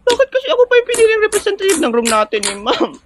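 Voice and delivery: put-on high, jittery girl voice; nervous girl pitched high before the quiz; nervous schoolgirl. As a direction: squeak it out, scared of the contest. Same nervous girl complaining.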